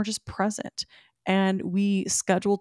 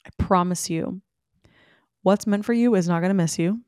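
ordinary talk

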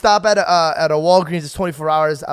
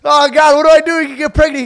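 higher, louder frantic plea; panicked son; 11 semitones higher, 9 dB louder than usual. Retold or imitated. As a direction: beg dad for help in panic. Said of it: "I was like".

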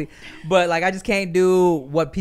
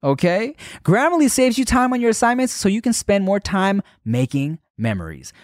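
higher, upbeat podcast sponsor read; 4 semitones higher than usual. Real, not acted. Host pitching a writing assistant to students, lively ad read. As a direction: sell it to the listeners.

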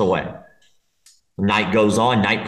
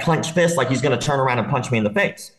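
ordinary talk

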